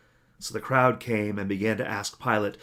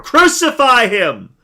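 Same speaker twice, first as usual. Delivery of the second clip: much higher, much louder, very slow drawn-out shout; the mob roaring even more; crowd shouting louder; 14 dB louder, much slower than usual. A narrator's reading. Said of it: "they shouted all the more".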